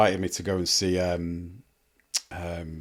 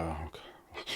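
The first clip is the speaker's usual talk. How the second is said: muttered groan; 4 semitones lower, 17 dB quieter than usual. Retold or imitated. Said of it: "and you're like".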